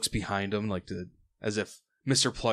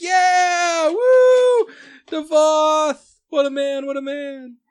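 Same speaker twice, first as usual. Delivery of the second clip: very high, loud whooping cheer; cheering crowd; 12 dB louder, much slower than usual. Acted. Crew applauding Devoth as whip goes overboard, ecstatic cheering. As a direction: holler in wild adulation. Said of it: "thunderous applause".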